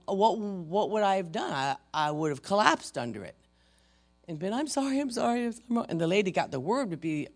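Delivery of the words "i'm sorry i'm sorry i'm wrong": high, meek imitated apology; mock apology. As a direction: cave in with a high-pitched sorry. Imagines collapsing under the accusation.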